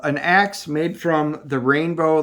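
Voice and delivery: normal talking voice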